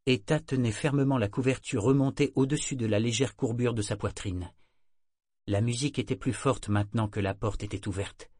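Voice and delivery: usual speaking voice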